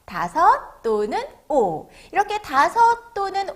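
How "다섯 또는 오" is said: slow, spaced-out repeat; read-along model. Shown as a demonstration. Teacher repeats the number five for children, slow read-along model. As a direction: stretch each word for the class to echo. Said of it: "다시 한번".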